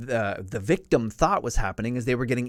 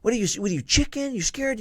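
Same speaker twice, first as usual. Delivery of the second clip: high, loud taunting yell; yelling officer; 10 semitones higher, 4 dB louder than usual. Retold or imitated. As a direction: yell at the recruit who won't move. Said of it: "started yelling at me".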